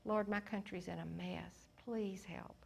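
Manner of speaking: quiet plea fading into a whisper; soft, humble plea for the country, ending whispered; hushed prayer. Retold or imitated. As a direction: breathe the last words like a secret prayer. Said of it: "That might be your first step to him is".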